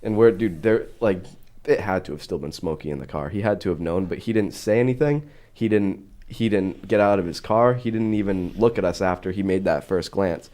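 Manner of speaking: ordinary talk, nothing marked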